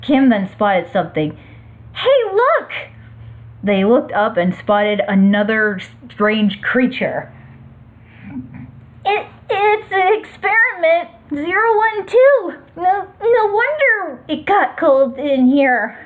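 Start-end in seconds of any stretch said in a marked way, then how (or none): 1.9-2.7 high excited call. Acted. Character calls out in story. 9.0-16.0 put-on high, startled young voice; high character voice.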